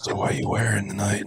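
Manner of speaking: low, leering phone-creeper voice. Acted. Mocking a long-distance suitor on the phone, creeper voice. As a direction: put on a mock creep on the phone. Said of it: "creeper in the phone going".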